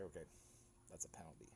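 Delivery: hushed, breathy aside to himself; quiet inner voice noting the penalty; whispered thought. Retold or imitated. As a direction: whisper it like a private realization.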